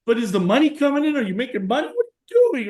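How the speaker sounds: high, fast worried questions; a fretting mother pressing her son; worried mom. Retold or imitated. Worried mother asking about money, high and fast. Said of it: "And she's like".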